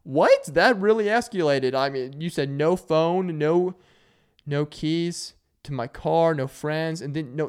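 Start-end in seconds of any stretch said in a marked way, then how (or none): 0.0-1.8 high, loud, incredulous; mock disbelief. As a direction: react like the punishment just went too far. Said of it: "You're like".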